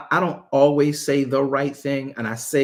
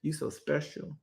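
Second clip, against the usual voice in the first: sly put-down; 2 semitones lower, 13 dB quieter than usual. Retold or imitated. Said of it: "I'm like".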